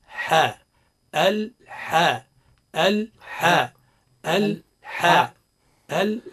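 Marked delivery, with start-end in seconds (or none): 1.1-6.3 "الحاء" slow, with a long breathy h from the throat; drawn-out haa. Shown as a demonstration. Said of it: "الصواب هو". (مدّ الحاء من الحلق)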